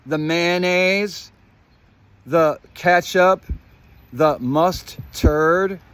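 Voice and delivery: each sauce drawn out slowly; deliberate list with long pauses; slow, loaded listing. Real, not acted. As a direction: split the words slowly to hint at puns.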